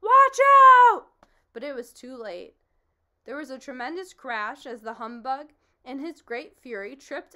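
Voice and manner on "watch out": very loud, high warning yell; alarmed yell. Acted. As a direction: yell before the crash. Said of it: "and then he yelled".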